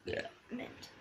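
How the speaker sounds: burped word; talking while burping. Shown as a demonstration. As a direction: burp out comment. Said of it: "That was comment".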